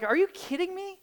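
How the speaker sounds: ordinary delivery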